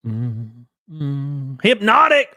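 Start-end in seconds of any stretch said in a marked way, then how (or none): 1.6-2.3 high, loud greeting shout; excited call-out. Real, not acted. Host greets guest Hypnotic joining the stream, excited shout. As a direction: sing out the name, pitched way up.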